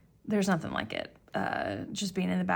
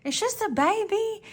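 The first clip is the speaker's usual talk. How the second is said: much higher little-kid voice, mimicking a three-year-old; imitating a toddler; 12 semitones higher than usual. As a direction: squeak it out like a tiny boy. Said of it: "little nephew Cohen just kept going".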